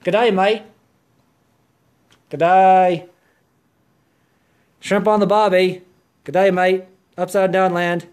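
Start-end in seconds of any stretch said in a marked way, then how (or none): 0.0-6.8 a hint of a mock Australian accent. Vlogger teasing Australian viewers, light mock Australian accent. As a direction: toss off some jokey Australian greetings. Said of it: "Australia reverse toilet land".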